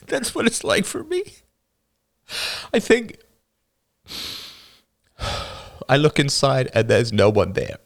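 put-on a young boy fighting back sobs, laughing bitterly; tearful boy. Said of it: "The younger boy burst into tears".

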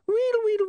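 very high wheedling gibberish; high squeaks dropping at the end; rewind squeal. Acted. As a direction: squeal like a tape winding back. Imp imitates rewinding noise.

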